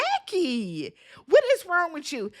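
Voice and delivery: high, incredulous question at Jackie; a viewer yelling at the TV in disbelief; shocked viewer. Retold or imitated. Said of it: "I was like".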